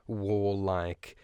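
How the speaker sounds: normal talking voice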